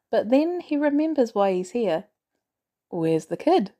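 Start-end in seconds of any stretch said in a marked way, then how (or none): none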